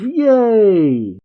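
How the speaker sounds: put-on high, drawn-out kid cheer; gleeful cheer. Pan learns Piccolo will train her, gleeful. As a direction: cheer like a delighted child. Voicing Pan's delight at training.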